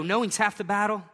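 ordinary delivery

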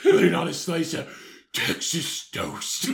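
slow, savouring superhero voice; fat Batman. Acted. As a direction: draw out the food like a hero. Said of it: "You're fat Batman".

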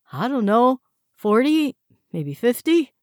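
high, loud, rattled answer; flustered guess. A narrator's reading. Frightened prisoner blurting an answer, high and loud.

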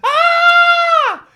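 high, loud shocked shriek; shocked scream. Retold or imitated. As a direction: shriek in alarm like a startled mom. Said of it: "my mom turns around and she goes".

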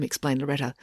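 ordinary delivery, nothing marked

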